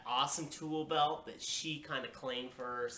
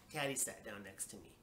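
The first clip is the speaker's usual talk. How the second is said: ordinary delivery, nothing marked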